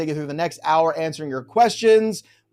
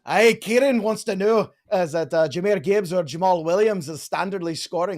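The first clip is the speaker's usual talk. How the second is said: put-on Scottish accent, done on request. Shown as a demonstration. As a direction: read it out as a playful Scot. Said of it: "Can you do a Scottish accent?".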